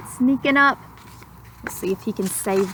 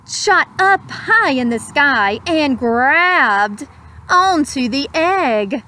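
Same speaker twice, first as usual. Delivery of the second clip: put-on slow, lilting read-aloud to children; gentle rhyming storyteller; storybook narration; 4 semitones higher, slower than usual. A narrator's reading. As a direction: savour each word like a bedtime story. Rhyming picture-book narration.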